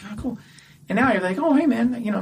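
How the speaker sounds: usual speaking voice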